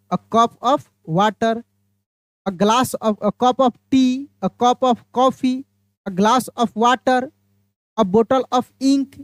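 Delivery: every phrase drawn out very slowly; very slow teacher's list of model phrases; slow dictation list. Shown as a demonstration. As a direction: read each phrase slowly for the class to copy. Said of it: "जैसे मैं बताया".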